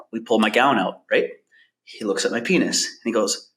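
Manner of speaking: usual speaking voice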